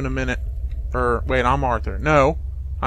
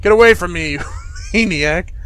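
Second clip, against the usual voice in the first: Arthur yells in fear and anger, much louder; angry shout; 2 semitones higher, 4 dB louder than usual. Acted. Arthur shouting at Hunter to get away, loud and angry. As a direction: scream it at the man who tied you up.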